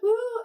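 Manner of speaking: a quick high whoop in mockery; mock woo. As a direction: toss in a sarcastic little woo. Mimes author adding love-triangle twist.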